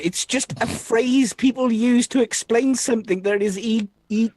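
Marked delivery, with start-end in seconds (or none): 3.6-4.3 stuck repeatedly on the first sound; glitching stutter. Acted. Arthur failing to explain a phrase to Hawk, stuttering. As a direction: stammer like a machine hitting an error. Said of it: "Are you having an error".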